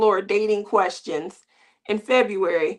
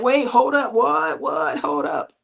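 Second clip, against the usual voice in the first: alarmed backpedal. Retold or imitated. Son realizing grandmother means marriage, alarmed backpedal. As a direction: slam the brakes in a panic.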